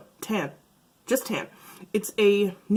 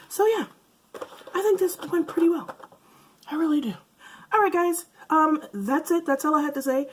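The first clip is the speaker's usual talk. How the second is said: bright upbeat; 9 semitones higher, 3 dB louder than usual. Real, not acted. Wrapping up brightly.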